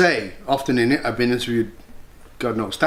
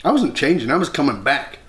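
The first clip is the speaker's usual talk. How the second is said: usual speaking voice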